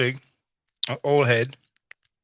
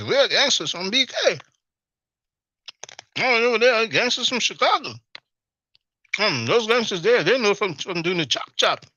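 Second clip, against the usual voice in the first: high excited bragging voice, pointing people out; show-off gangster tour guide; hyped-up hustler; 9 semitones higher than usual. Retold or imitated.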